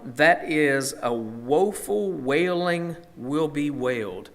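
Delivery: slow, drawn-out lament; woeful wailing. Acted. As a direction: stretch the words out like a mild wail. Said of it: "we should see emotion in that".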